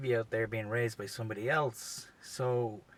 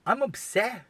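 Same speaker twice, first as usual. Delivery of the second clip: raised-pitch upset admission; 9 semitones higher, 4 dB louder than usual. Retold or imitated. Comic telling how the news hit him, upset. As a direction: admit it upset, pitched high. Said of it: "for real, I was, like, upset".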